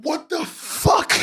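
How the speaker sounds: high and drawn out in disbelief; baffled. Real, not acted. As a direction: stretch it out, stunned. Baffled by the comic.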